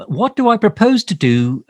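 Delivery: mock reading of a viewer question. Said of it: "Jeff asks".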